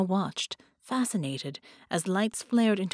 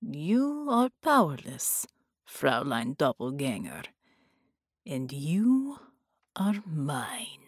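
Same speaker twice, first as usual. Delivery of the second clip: sorcerer gloats over helpless Carolina; triumphant villain; 4 semitones lower, slower than usual. Acted. Bela triumphant.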